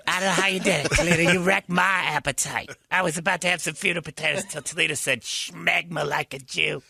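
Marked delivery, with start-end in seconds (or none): 5.2-6.8 put-on slow, overdone Yiddish accent; drawn-out Yiddish-accented quote. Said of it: "What's with the overpronunciations?".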